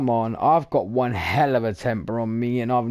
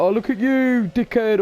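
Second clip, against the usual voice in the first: higher, louder mocking jab; mock insult; 9 semitones higher, 3 dB louder than usual. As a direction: sneer at someone lightly, pitched up. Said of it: "I could go out my way and be like".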